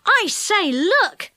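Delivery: high, eager call to look; excited cry. A narrator's reading. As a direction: call out in delight. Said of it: "he cried".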